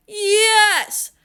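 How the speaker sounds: high, drawn-out yell back; stubborn insistence. A narrator's reading. Cashier arguing with the father, stubborn shout.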